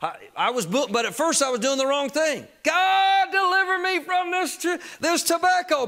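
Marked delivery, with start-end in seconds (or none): 2.6-5.9 crying out to God. Retold or imitated. Preacher re-enacts begging God to end his tobacco habit, crying out. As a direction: re-enact a desperate prayer, voice thrown way up. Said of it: "I kept crying out to God".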